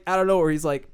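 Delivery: ordinary talk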